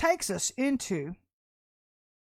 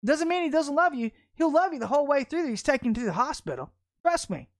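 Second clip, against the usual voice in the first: loud, higher, full-force sermon voice; fiery preaching; 6 semitones higher, 6 dB louder than usual. Acted. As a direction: preach it hard at the congregation.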